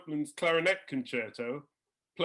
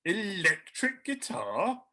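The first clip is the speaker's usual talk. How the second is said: slow, incredulous query; bemused old composer; 2 semitones higher, slower than usual. Retold or imitated. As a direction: draw it out in disbelief. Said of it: "shakes his head and goes".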